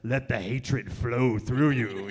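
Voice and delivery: slow, low sinister hiss; Emperor Palpatine. Retold or imitated. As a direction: tempt them slowly like a dark lord. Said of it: "Emperor Palpatine going like".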